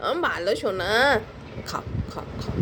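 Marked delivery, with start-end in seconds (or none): 1.7-2.6 whispered then soft low coaxing; hushed pleading to eat. Real, not acted. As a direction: coax him to eat under your breath.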